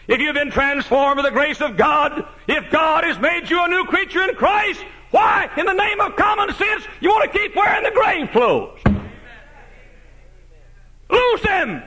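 high, loud preacher's cry; fiery preaching. Acted. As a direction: hammer it home from the pulpit. Preaching at full force.

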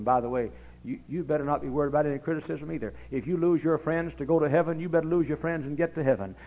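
usual speaking voice

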